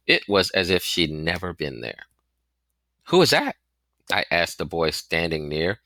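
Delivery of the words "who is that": put-on high, louder call of a curious boy; curious boy asking. A narrator's reading. Boy asks a stranger who the lady was, high and eager.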